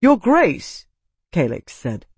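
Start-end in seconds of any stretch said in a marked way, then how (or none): 0.0-0.9 much higher, insistent call; outraged lord appealing for justice; indignant appeal. A narrator's reading. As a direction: demand his attention, affronted. Calix demands justice after insult.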